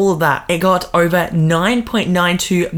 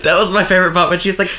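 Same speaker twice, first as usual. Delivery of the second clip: ordinary talk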